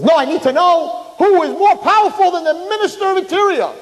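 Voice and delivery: high, loud, in a put-on voice; insistent demand. Minister of interior insisting to know who freed the father. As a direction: demand the answer, voice jumping up.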